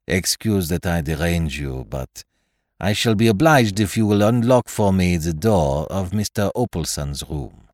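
polite French detective's request; French-accented detective. Acted. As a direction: ask courteously in a French accent. Poirot's dialogue in narration.

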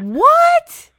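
very high, loud astonished yelp; shocked what. Real, not acted. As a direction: blurt it out in disbelief. Reacting to hundred sixteen revisions.

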